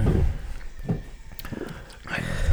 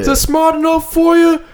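high, strained mock Jersey rant; hammed-up Jersey accent; 4 dB louder than usual. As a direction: rant like an indignant Jersey tough guy. Continues the Jersey tough-guy bit.

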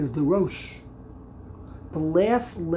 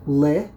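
"Le" punched out louder on its own; isolated syllable; 3 semitones lower, 6 dB louder than usual. Shown as a demonstration. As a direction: call out "Le" as a counted syllable. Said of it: "You break up the word".

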